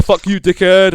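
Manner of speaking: ordinary talk, nothing marked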